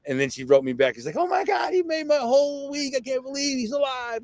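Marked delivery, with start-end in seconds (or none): 1.2-4.2 much higher, gushing with delight; excited squeal. Retold or imitated. Friend thrilled the hero survived, squealing excitedly. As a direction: squeal it like the best news of the week.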